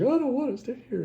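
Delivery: high, loud whiny plea; mock protest. Retelling her protest to Conan.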